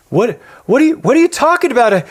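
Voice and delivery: high, loud stammering protest; incredulous son. Retold or imitated. Son told he didn't earn the money, incredulous yell. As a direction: act out a flabbergasted kid yelling back.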